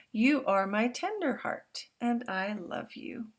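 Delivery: put-on storybook reading to young listeners; reading to children. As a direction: read it out big and warm for the class. Reads picture book aloud.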